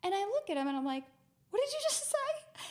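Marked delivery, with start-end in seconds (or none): 1.5-2.6 very high, incredulous retort; high-pitched, amused outrage; mock disbelief. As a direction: act out being stunned at the teasing. Retelling shock at being mocked.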